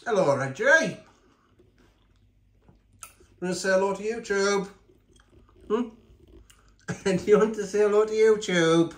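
high sing-song voice for the dog; pet talk. Real, not acted. To Reggie the pet, asking him to greet YouTube, high coo.

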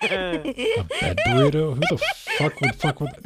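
high incredulous laughing question; surprised. Real, not acted. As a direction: squeal it in disbelief. Reacting to "bed burrito.

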